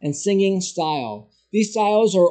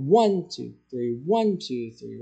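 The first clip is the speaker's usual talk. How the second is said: each "One" stronger, "two, three" falling away; stress on one; 5 semitones lower than usual. Shown as a demonstration. Teacher showing three four meter, stressing each "one". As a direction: count it with weight on every "one". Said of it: "the one gets the strong beat".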